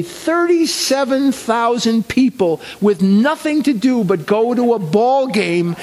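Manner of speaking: father's voice. Retold or imitated. Father marvelling at Tuesday crowds, high and amused. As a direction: play the delighted immigrant father.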